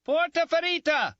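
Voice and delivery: very high, loud call for help; loud cupped-hands yell; shouting. Acted. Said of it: "I shouted".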